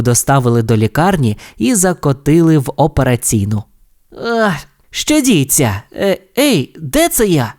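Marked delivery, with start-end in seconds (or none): 4.2-7.5 high, slow dazed questions; bewildered. Acted. Race car waking in surgery, dazed. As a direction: wake up confused. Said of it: "спантеличено спитав він".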